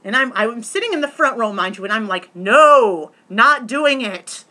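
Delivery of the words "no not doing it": loud, drawn-out mock-defiant refusal. Audience member in front row refusing the host, loudly defiant. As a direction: belt it out slowly, digging in.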